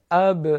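اب with a clear echoing ب; qalqala on b. Shown as a demonstration. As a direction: bounce the ب in اب. (قلقلهٔ حرف با)

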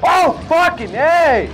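loud, slow, shocked yell; startled scream. Real, not acted. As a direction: scream at the sudden scare. Startled by a scare in game.

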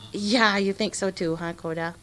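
usual speaking voice

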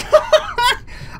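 high burst of laughter; relieved laughter. Real, not acted. Gamer after surviving the chase, high relieved laugh. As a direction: laugh out the tension.

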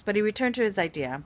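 ordinary delivery, nothing marked

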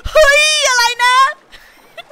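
very loud, high-pitched mock mom yell; mom's public outburst. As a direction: yell it like an outraged mom imitation. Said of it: "out loud in the line".

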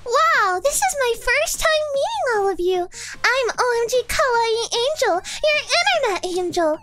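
high, cutesy baby-voiced streamer. Internet angel greeting everyone at her first stream, baby voice. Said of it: "I have to do the baby voice for her".

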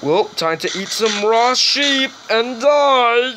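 a goofy character voice he can barely keep up; cracking up inside a high silly voice; put-on silly voice. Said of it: "It takes lots of work to make that voice".

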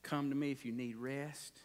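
quiet pastoral welcome; tender invitation. Retold or imitated. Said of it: "Hey, Jesus said".

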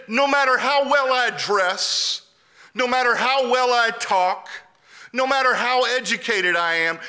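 high, emphatic retold plea; impassioned protest. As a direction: drive each repeated phrase home. Older friend protesting unfairness, retold.

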